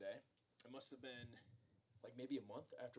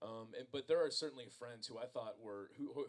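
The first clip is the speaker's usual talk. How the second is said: usual speaking voice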